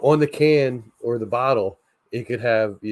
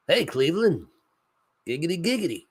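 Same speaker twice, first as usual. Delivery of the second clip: higher, sly cartoon catchphrase; Quagmire impression; 8 semitones higher than usual. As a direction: leer through the giggity. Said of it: "Oh, hey, Quagmire".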